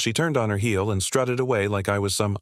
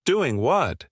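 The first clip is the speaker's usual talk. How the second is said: angry outburst; 6 semitones higher than usual. Acted. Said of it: "eyes twitching".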